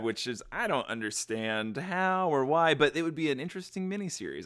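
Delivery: ordinary talk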